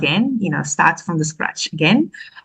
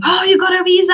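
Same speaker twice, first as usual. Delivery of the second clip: much higher, hopeful mother voice; light up at the news, pitched high; mother asking her daughter about the visa, high and hopeful; mom, surprised; 13 semitones higher, faster than usual. Retold or imitated.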